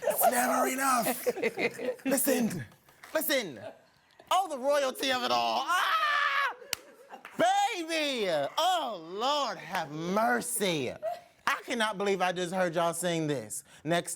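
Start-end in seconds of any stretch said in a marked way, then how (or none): 0.1-3.6 drawn-out laughing high exclaim. Real, not acted. Thrilled listener laughing after the song. 4.3-10.9 high laughing cry of joy; ecstatic shout. Real, not acted. Moved listener crying out after hearing them sing.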